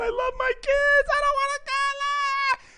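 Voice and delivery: high, slow acted-out wail; screaming and crying. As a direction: wail it as if pleading for life. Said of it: "I freaked out and screamed and".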